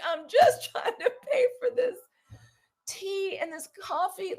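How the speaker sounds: high, loud laughing retelling; exasperated laughing. Retold or imitated. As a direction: protest through laughter, voice shooting up.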